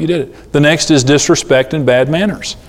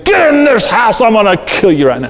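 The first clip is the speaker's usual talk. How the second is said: high-pitched, hammed-up angry parent; mock yell; 8 semitones higher than usual. Shown as a demonstration. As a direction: holler high at the kids, overdone. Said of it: "don't yell at them. Don't, don't run out there and say".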